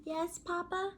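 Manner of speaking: high small voice; little chick voice. Acted. Chick answering Papa sweetly, high small voice.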